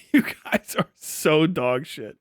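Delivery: normal talking voice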